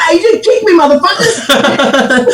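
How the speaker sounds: high, laughing mock outrage; laughing outburst. Real, not acted. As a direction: yell it while cracking up. Kicked from call, laughing.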